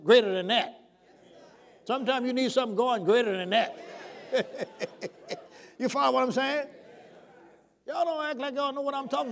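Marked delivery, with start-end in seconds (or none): 3.8-5.4 breathy, knowing chuckle. Real, not acted. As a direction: laugh softly to yourself. Preacher chuckles to himself.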